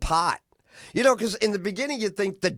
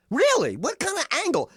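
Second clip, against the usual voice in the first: higher, doubtful studio-exec voice; lightly voiced, higher-pitched exec questioning a pitch; skeptical executive; 10 semitones higher than usual. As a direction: sound like a suit who isn't buying it.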